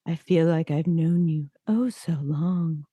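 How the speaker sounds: slow, droning podcast voice; creepy monotone. Retold or imitated. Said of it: "his creepy monotone affect podcast voice".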